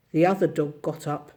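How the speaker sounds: plain reading voice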